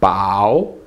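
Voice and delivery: BAO dipping low, then rising; low dip-and-rise on BAO; third tone. Shown as a demonstration. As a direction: let BAO sink and climb back up. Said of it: "Third tone".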